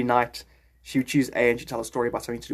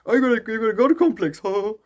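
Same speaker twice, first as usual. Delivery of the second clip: quick, high-pitched taunt; mocking accuser; 6 semitones higher, 4 dB louder than usual. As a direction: rattle it off as a jeering accusation. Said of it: "It'll go".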